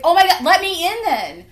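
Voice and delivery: high, loud mock of a frantic father; worried dad. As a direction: play the dad pounding on the door.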